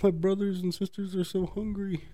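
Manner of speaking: high, whiny imitation of a begging scammer; hammed-up pleading; mock pleading scammer. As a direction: whine it up high, fake hungry. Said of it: "he's like".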